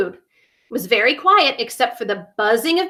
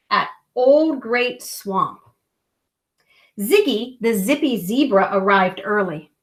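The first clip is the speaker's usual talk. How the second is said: put-on gentle read-aloud narration for children; storybook reading. A narrator's reading. Parent reading a bedtime story, gentle narration.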